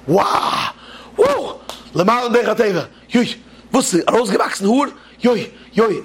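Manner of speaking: high excited cries of wonder; amazed crowd. Retold or imitated. Everyone marvels at the hair growing on the hand, high excited cries. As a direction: gasp and cheer at the miracle. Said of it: "alle werden".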